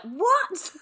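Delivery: sudden high baffled What; shocked, laughing disbelief; surprised. Retold or imitated. Mock baffled crowd reaction.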